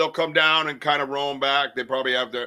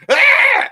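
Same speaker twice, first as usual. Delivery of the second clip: huge imitated sneeze, much higher and louder; mock sneeze; 12 dB louder than usual. Showing the podcast host his giant sneeze in the woods, loud mock sneeze. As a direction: blast out a startling fake sneeze. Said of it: "I just let out this sneeze".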